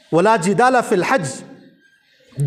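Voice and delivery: slow, drawn-out Arabic recitation; تلاوت کے انداز میں آہستہ; Quranic recitation. Acted. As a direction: recite the verse slowly and reverently. Quran verse recited in sermon.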